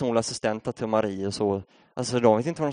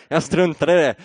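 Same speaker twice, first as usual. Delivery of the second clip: bursting out louder and higher, still amused; laughing correction; 6 semitones higher, 8 dB louder than usual. Real, not acted. Preacher corrects his swear word to the congregation, laughing loudly. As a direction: cover the slip with a laughing, louder restatement.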